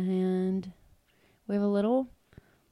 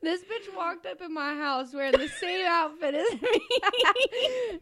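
laughing; 8 semitones higher, 4 dB louder, slower than usual. Real, not acted. Mock outrage at matching outfit.

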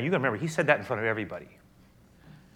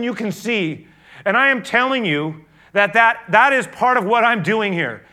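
loud, high, impassioned sermon build; rising pulpit fervor, louder and higher; full-force preaching; 9 semitones higher, 9 dB louder than usual. Acted. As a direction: preach it out to the whole room.

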